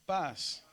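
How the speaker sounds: "paz" con la A estirada en arco; alargado, sube y baja. Shown as a demonstration. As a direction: alarga la A de "paz" para que la repitan. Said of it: "se alarga un poco y sube y baja". ("paz" con vocal larga que sube y cae)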